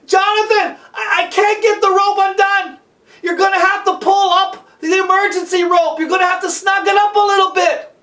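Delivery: high, loud, urgent holler; calling up. Acted. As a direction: shout a request up the cliff. Climber calls up for help.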